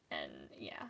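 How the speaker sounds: breathy whisper trailing off; whispered aside. Real, not acted. Finishing the box story in a trailing whisper. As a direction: drop to a whisper.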